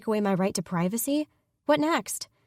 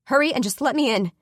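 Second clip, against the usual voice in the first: loud, urgent and impatient; impatient, worried call; 7 semitones higher, 6 dB louder than usual. Acted. Sister outside the door demands to be let in, impatient and worried. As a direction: call out, pressing and anxious. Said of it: "she calls out, sounding impatient and worried both".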